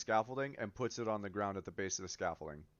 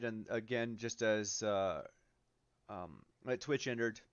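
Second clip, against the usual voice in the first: ordinary delivery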